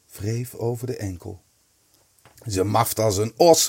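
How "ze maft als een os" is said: mildly tittering, louder; a touch of a stifled giggle; giggling. A narrator's reading. Kees mocking the sleeping fishwife to his friends, giggling. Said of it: "giechelde Kees".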